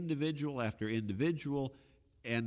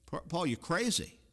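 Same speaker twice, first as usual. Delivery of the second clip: mock outburst; 7 semitones higher than usual. Paraphrasing Festus's outburst at Paul.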